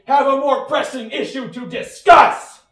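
loud. Acted. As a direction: bark it to get back to business. Cop snaps back to business.

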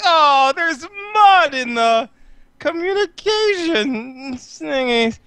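high, slow fretting; dismayed nerd. Acted. August fussing over his terminal, high and slow. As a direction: fret over the mud.

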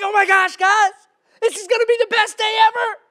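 high, loud, giddy excitement; fired up. Acted. Giddy dad to his boys at Disney, fired up. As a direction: gush it like an overexcited schoolboy. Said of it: "I'm just fired up".

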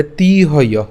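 তিহয় with a long, held ee in তি; lengthened তি in তিহয়, breath at the end; long vowel. Shown as a demonstration. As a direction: stretch তিহয় to tee-hoyoh. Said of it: "একটু দীর্ঘায়িত করতে হবে".

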